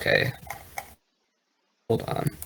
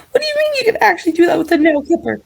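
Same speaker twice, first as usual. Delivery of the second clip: fast, very high squeaky voice; chipmunk voice; much faster than usual. Acted. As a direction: rattle it off like a chipmunk. Sped-up high repeat.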